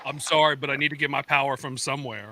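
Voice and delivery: low, menacing villain. Pretend. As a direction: growl it like a cartoon villain. Playing villain sacrificing friends.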